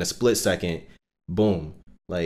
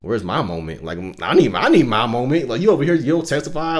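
mock indignant griping, building louder and higher; imitated complainers; 3 semitones higher, 4 dB louder than usual. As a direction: act out a jealous doubter demanding their turn. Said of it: "a lot of people say that stuff".

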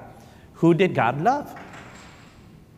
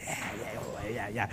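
congregation murmuring; 7 semitones lower, 16 dB quieter than usual. Retold or imitated.

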